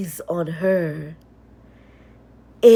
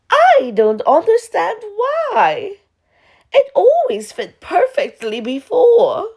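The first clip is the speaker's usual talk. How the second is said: high, loud, nervous laughing voice; a stepsister laughing off her failure; flustered stepsister; 12 semitones higher, 5 dB louder than usual. Acted. Stepsister to the Duke, high and nervously laughing.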